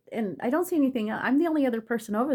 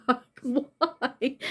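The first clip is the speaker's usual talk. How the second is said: mock tearful protest; 2 semitones higher, much slower than usual. Woman retelling her fall in tears, mock tearful through laughter. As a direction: laugh breaking into a retold tearful why. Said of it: "already pouring from my eyes".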